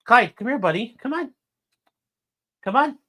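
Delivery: high, sing-song pet talk; coaxing the dog. Real, not acted. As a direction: coax the dog up, sweet and high.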